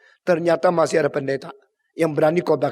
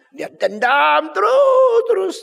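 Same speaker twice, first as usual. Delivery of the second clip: dramatic shout; 14 semitones higher, 7 dB louder, slower than usual. A narrator's reading. Preacher stressing grudge.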